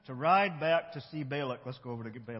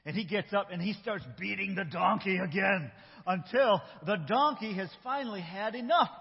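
usual speaking voice

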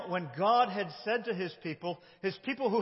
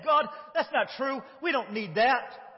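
defiant mock; 6 semitones higher, 4 dB louder than usual. Voicing defiant people.

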